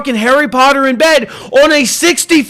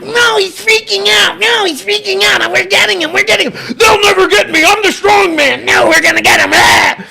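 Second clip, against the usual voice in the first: high-pitched, hammed-up frantic shouting; mock freak-out; 8 semitones higher than usual. Streamer showing he can act freaked out, mock hysterical. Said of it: "I can act like this and I'm not freaking out".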